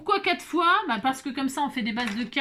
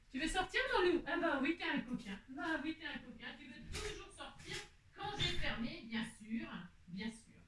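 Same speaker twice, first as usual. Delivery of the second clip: soft, high, cooing pet talk; talking to her pet; 5 semitones higher, 13 dB quieter than usual. Real, not acted. Owner coos quietly to her pet, soft and high. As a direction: coo gently at the little dog.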